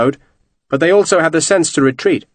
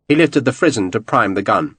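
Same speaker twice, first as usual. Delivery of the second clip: ordinary delivery, nothing marked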